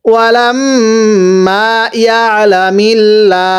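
slow chant with hummed ম and long লা; repeat recitation. Shown as a demonstration. Teacher repeats for students, slow recitation. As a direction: hum ওয়ালাম, stretch the final লা. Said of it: "আরো একবার পড়ব".

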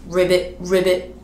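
frog croak. Pretend. Teacher in frog song, croaking ribbit.

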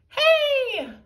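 high, loud indignant cry. A narrator's reading. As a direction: protest with a sharp loud cry. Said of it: "cried slightly younger Tiny Tippy".

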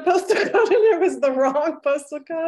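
laughing as she tells it. Real, not acted. Confessing a shipping mishap to the group, laughing. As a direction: laugh through the admission.

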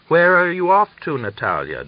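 curious sister asking; girl's question. A narrator's reading. Young sister asks Natalia where she is going. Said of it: "Dunya asked".

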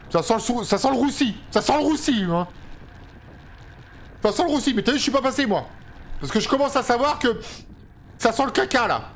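high-pitched, urgent excitement; getting alarmed as the race heats up; excited. Real, not acted. Tense race moment, rising alarm.

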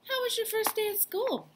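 put-on high, sweet old grandma voice asking gently. Grandma greets Dexter after school.

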